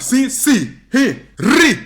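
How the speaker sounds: high, loud fighter's exhalations; imitated punching grunts. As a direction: voice each punch sharply. Imitates mom's punching noises.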